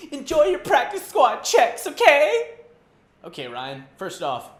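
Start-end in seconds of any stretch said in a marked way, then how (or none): 0.1-2.5 high, loud taunting yell; mock yell. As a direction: gloat loudly at the teammates. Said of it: "he yells at him".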